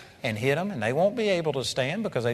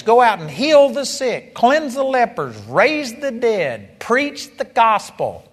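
proclaiming command; 7 semitones higher, 6 dB louder, slower than usual. Retold or imitated. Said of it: "He commanded us".

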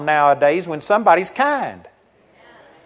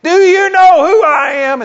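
much higher, loud mock shout of a man insisting he is important; the arrogant traveller blows up at the clerk, imitated yell; outraged shout; 13 semitones higher, 10 dB louder than usual. Entitled passenger repeating himself at the desk, outraged mock shout.

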